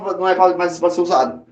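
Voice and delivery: normal talking voice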